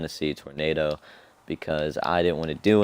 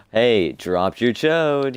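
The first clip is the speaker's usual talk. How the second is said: loud slow prank call; 5 semitones higher, 6 dB louder, much slower than usual. Retold or imitated. Pranking strangers at Dollywood.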